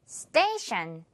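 스테이션 with the last syllable said as shan; slow model word, 스테이션 ending in shan not tion. Shown as a demonstration. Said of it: "티아이오엔 부분 샨 처리해주면서".

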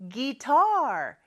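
"guitar" with a long, stressed second syllable; drawn-out model of "guitar", gui-TAR. Shown as a demonstration. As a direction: lean hard on the "tar" of "guitar". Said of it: "이음절에 강세가 있죠".

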